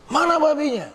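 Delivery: high, demanding angry question; angry father. Retold or imitated. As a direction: snap the question like a furious father. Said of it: "si bapak marah sekali". (tanya ayah yang marah, nada tinggi)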